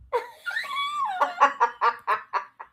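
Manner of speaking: high breathless giggling; high giggle. Real, not acted. Recalling her dad's fury, giggling high. Laughing about dad.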